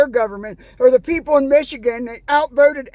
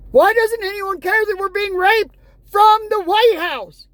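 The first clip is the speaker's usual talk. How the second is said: yelling; 6 semitones higher than usual. Real, not acted. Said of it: "me yelling".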